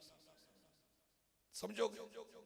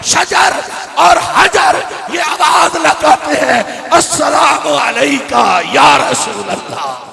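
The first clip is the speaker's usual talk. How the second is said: loud, ringing sermon delivery; preaching at full force; 7 semitones higher, 20 dB louder, slower than usual. Acted. Preacher rousing the congregation, loud impassioned preaching. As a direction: proclaim it to the whole mosque.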